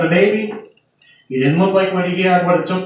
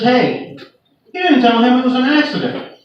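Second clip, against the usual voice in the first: higher, earnest plea that it was an accident; insistent patient; 6 semitones higher than usual. Retold or imitated. Patient urging the nurse to tell the doctor, insistent and higher. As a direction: press the nurse to add the detail.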